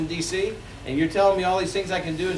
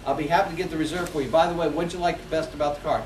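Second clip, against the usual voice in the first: rattled off fast, no pause before the question; rushed script. Shown as a demonstration. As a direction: hurry through it like a memorized line.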